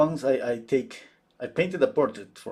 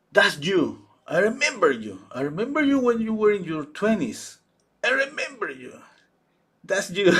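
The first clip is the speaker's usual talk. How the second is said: higher and louder, eager, pointing it out; excited recognition; 8 semitones higher, 5 dB louder than usual. Retold or imitated. Friend recognises her in the portrait, excited. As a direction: insist you remember her face.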